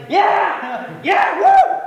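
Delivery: high, loud cheer ending in a shriek; triumphant whooping. Acted. Celebrating an imagined revenge fart.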